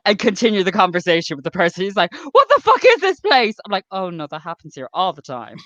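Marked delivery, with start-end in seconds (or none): 2.3-3.5 high, loud, appalled exclamation; acted shocked outburst. Shocked customer after hearing abuse, acted loud outburst. 3.9-5.6 low, soft, casual brush-off; acted unbothered reassurance. Barista to shocked customer, low unbothered reassurance.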